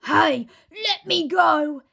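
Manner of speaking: loud, high, slow crocodile yell. Acted. Crocodile dangling upside down yells at Trunky. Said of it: "yelled the enormous crocodile".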